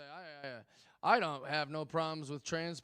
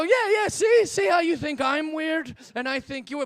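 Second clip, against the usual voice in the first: higher and louder, eager and pleased with himself; excited retort; 8 semitones higher, 8 dB louder than usual. Retold or imitated. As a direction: bounce it out, loud and high, like you've just proved a point. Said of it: "And I go".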